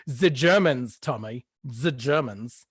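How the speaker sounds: hammed-up German accent; cartoon German accent. Shown as a demonstration. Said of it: "more of a cartoon impression, really".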